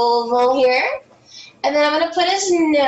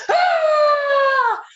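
high, loud, held "ah" scream; demonstration yell; 12 semitones higher, 6 dB louder than usual. Shown as a demonstration. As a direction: yell out one long high note. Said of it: "we're gonna have to yell".

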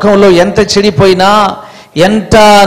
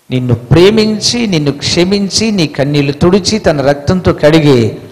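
tender comfort; 7 semitones lower, 5 dB quieter than usual. Acted. Tender promise of Jesus' comfort.

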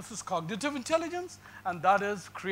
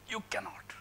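a sudden hushed whisper; emphatic whisper; 8 dB quieter than usual. Acted. Lecturer whispers a warning to students: hushed whisper. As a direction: drop to a whisper to drive it home.

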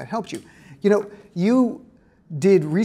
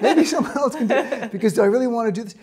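higher, laughing through a teasing made-up line; laughing mock quote; 5 semitones higher than usual. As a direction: tease her by voicing her reluctance with a laugh.

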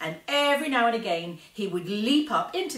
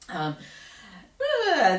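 ordinary delivery

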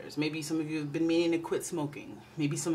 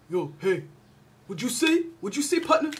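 hammed-up tough guy greeting, louder; mock deep dude voice; 6 semitones higher, 5 dB louder than usual. Playing her own voice gone macho after too long without nails done. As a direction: bark it like a macho partner. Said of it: "my voice gets deeper after a while".

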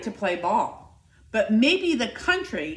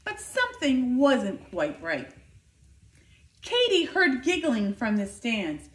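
plain reading voice

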